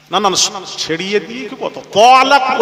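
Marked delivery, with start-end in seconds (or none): 1.9-2.6 তলাক said loud with open o; drawn-out তলাক, not তালাক; mocking mispronunciation. Preacher imitating the wrong তলাক. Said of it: "ওইটা উচ্চারণ করো".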